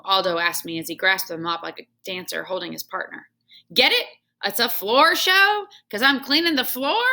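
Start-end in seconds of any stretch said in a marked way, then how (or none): 3.7-7.1 higher, louder punchline; joking janitor. Acted. Janitor pushing his joke on the hamster, gleeful. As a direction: sell the pun with glee.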